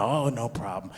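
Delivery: soft mock-meek acceptance; a touch of hushed, apologetic customer; too nice. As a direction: let it go quietly and politely. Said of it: "we're all just too nice".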